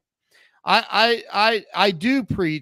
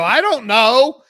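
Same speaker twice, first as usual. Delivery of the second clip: flustered reply; 7 semitones higher, 10 dB louder, slower than usual. Retold or imitated. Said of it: "I go".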